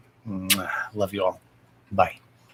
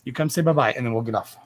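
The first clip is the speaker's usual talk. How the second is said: usual speaking voice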